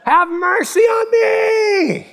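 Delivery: very high, drawn-out scream; wailing, far-carrying beggar's shout; desperate yelling. Retold or imitated. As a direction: scream for mercy at full stretch. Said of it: "he just yelled even louder".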